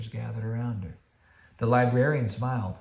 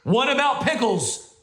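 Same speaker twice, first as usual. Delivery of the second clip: commanding; 13 semitones higher, 6 dB louder than usual. Acted. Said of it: "commanded Bear".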